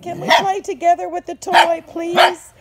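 high, loud begging dog voice; dog's pleading voice. Retold or imitated. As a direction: plead like a polite puppy. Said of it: "He's like".

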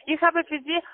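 ordinary delivery

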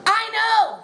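lightly mock-emotional agreement. Director answering the fallen actress, lightly emotional. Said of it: "very emotional".

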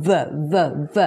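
"V" as a short buzzing consonant, vuh; three separate short "V" sounds; isolated V sound. Shown as a demonstration. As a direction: drill "V" as vuh, one at a time. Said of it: "ตัว V นะคะ".

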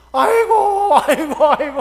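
high, loud comic wail; failed prayer shout. Retold or imitated. Imitating dreamer trying to shout to the Lord, loud wail. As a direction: cry out hard, hammed-up and helpless. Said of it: "외친다고 외치는데".

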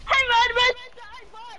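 calling out. Real, not acted. Player demanding a high five from teammate, calling out high. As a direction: call out eagerly to a teammate.

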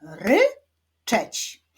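"Ryczeć" as RY... CZEĆ, two separate beats; "Ryczeć" split, rising ry then falling czeć; syllable by syllable. Shown as a demonstration. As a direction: clap out "Ryczeć" with a pause after ry. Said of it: "Wyklaszczmy sylaby w wyrazie ryczeć".

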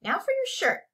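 high-pitched command; ordering. A narrator's reading. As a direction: order him about, voice lifted. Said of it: "ordered Jeremy".

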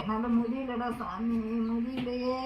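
broken grief. Acted. Wife breaking down in grief.

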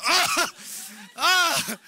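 stunned oh. Retold or imitated. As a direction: let the shock hit you out loud. Stunned by wife's words.

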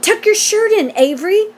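high-pitched scolding call; mild cry. A narrator's reading. Missus Zuckerman to busy Avery, mildly crying out. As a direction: call out to an untidy boy, lightly acted. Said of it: "cried Missus Zuckerman".

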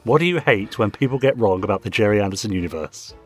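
ordinary talk, nothing marked

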